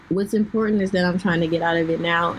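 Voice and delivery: ordinary delivery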